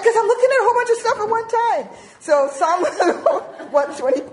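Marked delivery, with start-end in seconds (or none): 0.0-1.9 very high, loud, fast outburst; hammed-up defensive comeback; exasperated retort. Wife answering husband about open tabs, exasperated. Snaps back at husband's question.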